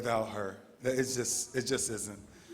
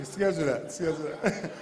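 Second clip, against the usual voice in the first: laughing; 2 semitones higher than usual. Real, not acted. Joking to Dave, laughing.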